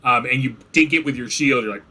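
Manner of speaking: normal talking voice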